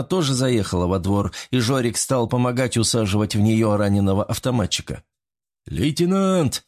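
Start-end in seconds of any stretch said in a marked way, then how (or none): 5.7-6.6 restrained narrator's shout; narrated call. A narrator's reading. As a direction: call out to the lieutenant, understated. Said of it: "закричал Буторин".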